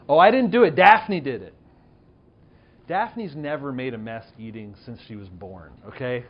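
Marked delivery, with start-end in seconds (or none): 0.1-1.5 put-on whiny kid denial. Son's whiny denial acted out.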